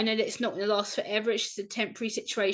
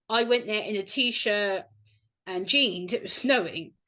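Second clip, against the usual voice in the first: ordinary talk, nothing marked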